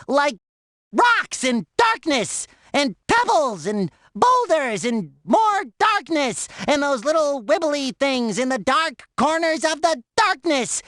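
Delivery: ordinary delivery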